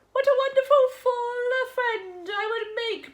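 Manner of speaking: high, loud boastful goat voice; bragging goat. A narrator's reading. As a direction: show off like a vain goat. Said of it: "bragged the goat".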